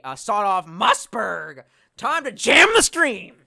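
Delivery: loud, high, slow hype; excited boast. Real, not acted. Streamer showing chat his shotgun, loud hype. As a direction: hype up the gun.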